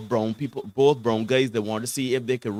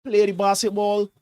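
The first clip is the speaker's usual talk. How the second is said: higher, louder imitation of a coach yelling; mock sideline scream; 7 semitones higher, 5 dB louder than usual. Coach screaming at his team from the sidelines, imitated yell. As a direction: bark it like a coach pleading from the bench. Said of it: "man was screaming on his sidelines".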